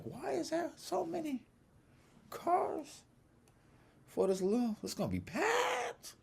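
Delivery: very high squeaky disbelief; mock dismay. Teller squeals in high mock dismay at the packed lot. Shocked by the crowded parking lot.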